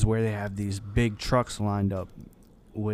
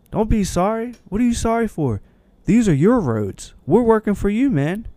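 much higher, upbeat and louder; cheery flagger; 11 semitones higher, 4 dB louder than usual. Retold or imitated. Flagger telling the apologizing driver these are his roads, high and cheery. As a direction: brush off the apology with bright good cheer.

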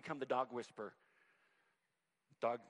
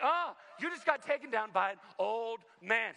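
gloating yell; 8 semitones higher, 8 dB louder than usual. Retold or imitated. Gloating after tagging a student.

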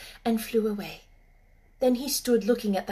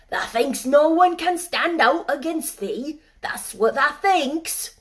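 louder, mocking Yorkshire old man; sarcastic old gardener; 6 semitones higher, 7 dB louder than usual. Ben mocking the robin's courting, sarcastic old Yorkshireman. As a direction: tease the bird mercilessly.

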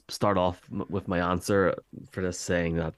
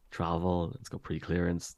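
normal talking voice

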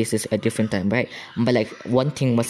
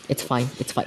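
ordinary talk

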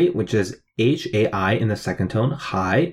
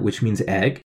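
normal talking voice

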